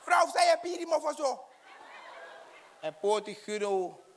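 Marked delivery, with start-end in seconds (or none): 0.0-1.4 high, loud comic yell; high shrieking question; shouting Gerald. Acted.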